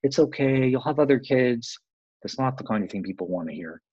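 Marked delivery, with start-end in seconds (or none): 0.0-1.8 higher, bright mock soothing; hammed-up fixer brushing off a grieving mother; mock reassurance. Caregiver hurrying to fix a mother's grief, mock reassurance. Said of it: "we try and fix things".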